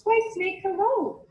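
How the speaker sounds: high bright greeting; cheery mouse greeting. Acted. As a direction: mouse sweetly greeting the snake.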